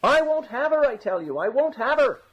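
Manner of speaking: very high cry, dipping midway. A narrator's reading. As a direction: call out the refusal in a high wail. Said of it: "this time he said it louder".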